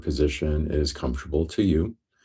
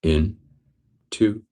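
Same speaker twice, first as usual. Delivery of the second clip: measured meditation count, each number set apart; breath count. Real, not acted. Guide starting listeners on a breath count, measured counting. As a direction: count them into the breath, evenly spaced.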